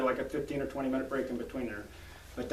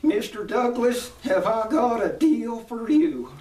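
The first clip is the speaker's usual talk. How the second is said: high, loud, drawn-out character voice; slow, high-pitched huckster's pitch; Mister Haney; 10 semitones higher, 9 dB louder, slower than usual. Acted. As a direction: play the sidekick salesman, stretched out loud and high. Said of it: "I always have to think of his voice".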